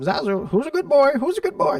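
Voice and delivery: high squawky parrot imitation. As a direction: mimic a talking bird, high. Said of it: "That's all he says".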